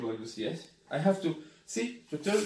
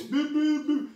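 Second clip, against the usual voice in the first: imitated warning beeps; 11 semitones higher than usual. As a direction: beep it out like the scooter's speed alarm. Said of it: "And all city hear the voice".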